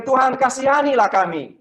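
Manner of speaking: high, loud pleading call; crying out from afar. Retold or imitated. Leper among friends shouting to Tuhan from far off, high loud cry. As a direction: raise the voice as if calling across a distance. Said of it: "Dari jauh dia teriak".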